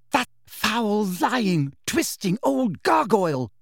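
high-pitched indignant fury; outraged schoolgirl venting; storming. A narrator's reading. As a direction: spit out each insult. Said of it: "stormed Hermione half an hour later".

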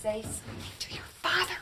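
hushed, voiceless aside; soft whispered nudge; whispered prompt. Acted. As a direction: whisper it to the child, unheard by others. Mother prompts son under her breath.

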